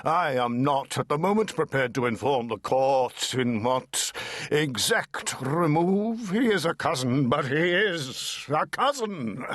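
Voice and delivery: booming little counsel; small lawyer with a big voice, lightly done; terrific bass voice. Acted. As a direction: fill the rafters with a big voice. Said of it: "a terrific bass voice".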